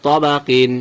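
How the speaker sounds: তবাকিন opening on rounded "taw"; thick ত, then long "baa" in তবাকিন; heavy pronunciation. Shown as a demonstration. Teacher answering with the example word, heavy rounded start on তবাকিন. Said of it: "তাহলে কি হবে?".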